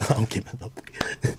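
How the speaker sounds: hushed and slow whisper. Real, not acted. Telling Jack he keeps the beat, whispered. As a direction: breathe it under the music.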